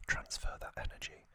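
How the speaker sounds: ordinary talk, nothing marked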